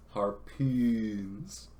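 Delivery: ordinary delivery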